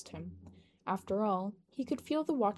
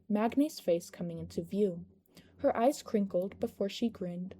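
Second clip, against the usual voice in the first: ordinary delivery, nothing marked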